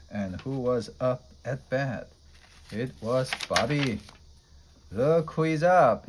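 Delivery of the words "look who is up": loud, higher mocking yell; yelled taunt. A narrator's reading. Rival team taunting Bobby at bat, loud jeer. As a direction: jeer at the batter loudly. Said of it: "yelled the Sluggers".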